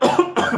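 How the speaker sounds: high, sharp cough; sudden demonstrative cough; acted coughing. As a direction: imitate a coughing fit for the story. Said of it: "Pero hagan de cuenta".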